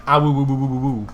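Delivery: lightly voiced husky babble; mild dog-talk imitation; imitated dog woo-wooing. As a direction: woo like a chatty dog, not barking. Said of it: "not barking, but, like, just going, like".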